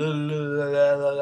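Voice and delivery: repeated "l" sounds run together; tongue-tied l sounds. Shown as a demonstration. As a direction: babble a string of "l, l, l". Mimics clashing repeated l sounds.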